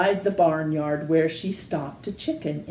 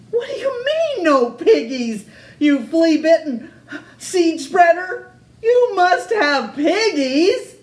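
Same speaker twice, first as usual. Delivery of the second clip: screeching; 9 semitones higher, 5 dB louder than usual. Acted. Witch yells at farmer.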